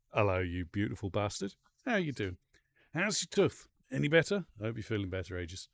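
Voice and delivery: ordinary talk, nothing marked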